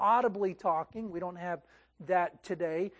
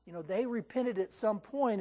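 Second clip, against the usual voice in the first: normal talking voice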